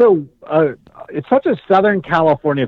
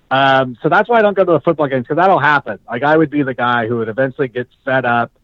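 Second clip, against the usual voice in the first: ordinary delivery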